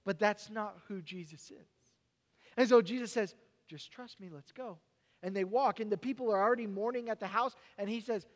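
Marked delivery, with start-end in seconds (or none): 3.7-4.8 gentle reassurance. Acted. Jesus reassures quietly.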